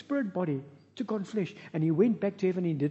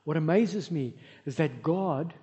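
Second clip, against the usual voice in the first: ordinary delivery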